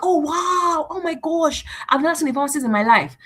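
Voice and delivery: high-pitched mock amazement; amazed reaction. Stranger meeting a pharmacist, acted amazed. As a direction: gush in awe, pitched well up.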